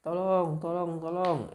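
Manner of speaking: high, loud drawn-out mock cry for help; berteriak minta tolong. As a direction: call out loudly, playing the lying farmer. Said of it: "petani ini berteriak lagi". (teriakan minta tolong yang diperagakan, tinggi dan keras)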